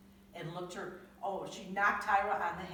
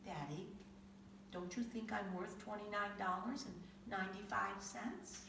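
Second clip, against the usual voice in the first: much softer, small questioning voice; quietly; 3 semitones higher, 8 dB quieter than usual. Acted. Tyra asking her daddy her worth, quiet soft question. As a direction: ask it softly and gently. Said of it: "Tyra quietly said".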